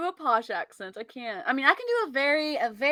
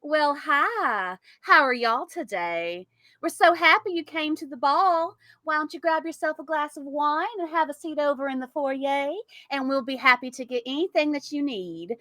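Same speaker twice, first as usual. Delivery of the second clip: Southern Belle accent; 2 semitones higher than usual. Shown as a demonstration. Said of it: "I can do a Southern Belle accent".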